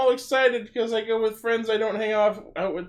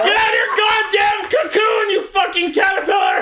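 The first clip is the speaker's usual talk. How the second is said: angry shout; 10 semitones higher than usual. Real, not acted. Yelling at friend to help.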